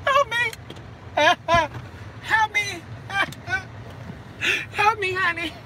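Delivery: high laughing cry for help; laughing mock plea. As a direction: giggle through a fake plea.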